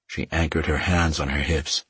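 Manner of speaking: plain reading voice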